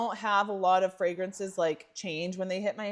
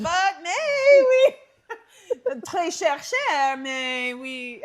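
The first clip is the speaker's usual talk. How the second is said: laughing mock French; 9 semitones higher, 8 dB louder, much slower than usual. Joking about pricey shopping.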